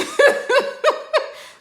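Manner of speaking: high loud laughing burst; burst of laughter. Real, not acted. Laughing at her own story's ending, loud and high. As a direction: laugh out before catching it.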